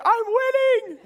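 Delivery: put-on high, loud little-boy voice, proud; imitating the son. Dad voicing his racing son's boast, high and gleeful. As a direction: play the kid bragging mid-race. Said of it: "He's like".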